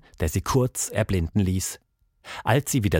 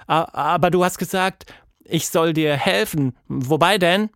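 put-on higher girl's voice, stammered start; hesitant young heroine; 7 semitones higher than usual. Girl asks monster what help is needed, higher and hesitant. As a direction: falter first, then question, pitched up.

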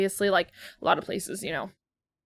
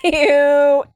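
disgusted cry; 6 semitones higher, 9 dB louder than usual. Real, not acted.

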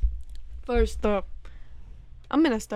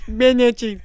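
loud New York "Bacon, egg, cheese"; New York accent; 9 dB louder than usual. Retold or imitated. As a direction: clip it like a bodega order. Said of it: "a way that New Yorkers say it".

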